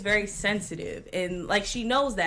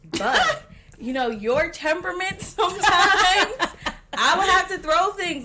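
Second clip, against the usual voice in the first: laughing; 10 semitones higher, 7 dB louder than usual. Real, not acted. Teasing about her temper.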